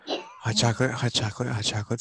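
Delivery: low, soft creepy murmur; eerie low hush. Pretend. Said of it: "Okay, creepy voice, all done".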